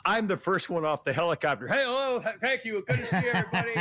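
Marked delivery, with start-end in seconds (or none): 1.7-3.7 higher-pitched gushing hello to a crowd; mock grand greeting. First one off the helicopter greeting TV crews, mock grand greeting. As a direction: greet the crowd like an arriving star, hammed-up.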